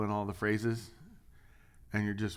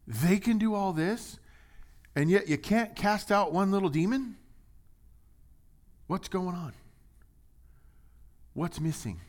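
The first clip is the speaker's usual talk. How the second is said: incredulous preaching; 9 semitones higher than usual. Acted. Preacher asking the congregation why the disciples failed, incredulous. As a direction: press the question in disbelief. Preaching climax, rising pitch.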